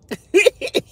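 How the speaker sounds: short high laugh rising at the end. Real, not acted. Host amused by her own wishing, laughing. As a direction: let out a high little laugh.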